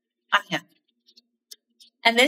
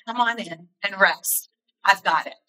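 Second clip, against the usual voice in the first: gentle reassurance; 5 semitones lower than usual. Retold or imitated. Jesus comforting weary speaker.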